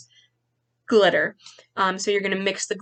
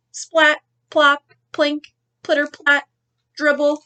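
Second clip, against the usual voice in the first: higher bouncy rain noises; playful sound words; 5 semitones higher, 4 dB louder than usual. A narrator's reading. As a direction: make each drop sound fun.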